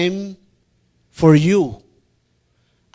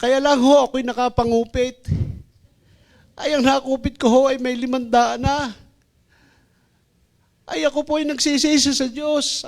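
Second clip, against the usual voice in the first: put-on confessing girl; 5 semitones higher than usual. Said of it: "Sabi niya".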